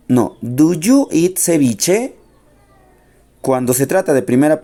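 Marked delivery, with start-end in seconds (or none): 0.4-2.2 slow model question. Shown as a demonstration. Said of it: "do se va al inicio para la".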